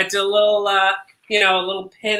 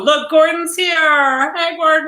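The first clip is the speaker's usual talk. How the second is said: excited greeting; 8 semitones higher, 3 dB louder than usual. Real, not acted.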